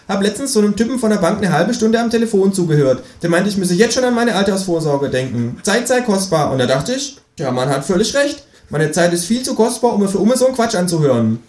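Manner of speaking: high kangaroo voice, pleased with itself; the kangaroo gloats about its scheme; cheeky kangaroo. A narrator's reading. Kangaroo explains its plan.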